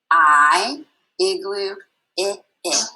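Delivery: "I" said as the short i of igloo; short i sound. Shown as a demonstration. Teacher drilling the short I sound with flashcards. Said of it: "Let's go to I".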